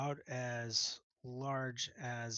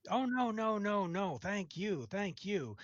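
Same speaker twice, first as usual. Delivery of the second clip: higher, eager insistence; effusive cook; 7 semitones higher, 4 dB louder than usual. Acted. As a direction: gush back at the customer.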